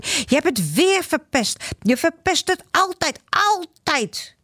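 put-on high, accusing child's cry; a child scolding his friend in anger; angry boy yelling. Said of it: "staat Joran te schreeuwen".

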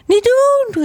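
high little eaglet protest; panicked cry. A narrator's reading. Little eagle being nudged, panicked cry. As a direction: cry out in alarm to stop it. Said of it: "riep hij".

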